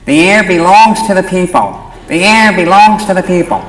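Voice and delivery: high pinched nasal radical voice; loud nasal activist chant. Retold or imitated. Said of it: "I spoke in a nasal voice".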